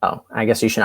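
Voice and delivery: ordinary talk, nothing marked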